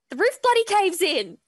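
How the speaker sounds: loud, high punchline; dramatic reveal. Real, not acted. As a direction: land it like a big twist. Dramatic climax of storm story.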